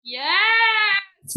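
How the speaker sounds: loud high delighted shout; excited yes. Real, not acted. Guest reacting to her own old tweet, delighted loud cheer. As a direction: burst out cheering with real delight.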